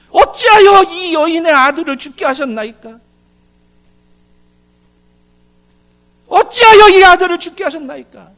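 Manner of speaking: full-force high cry to God; screamed prayer. Shown as a demonstration. Elijah cries to God over the dead son, screaming lament. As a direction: scream it out in desperate protest. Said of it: "제가 큰 소리 냅니다".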